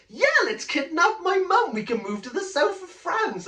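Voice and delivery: excited son. Retold or imitated. Son cheerfully proposing kidnap.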